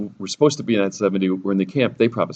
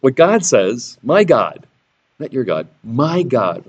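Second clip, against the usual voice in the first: normal talking voice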